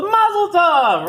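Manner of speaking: high, loud, slow joyful cry; joyful mazel tov. Retold or imitated. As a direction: burst out with delight at the news. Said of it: "face lights up, and she goes".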